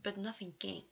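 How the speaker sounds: ordinary delivery, nothing marked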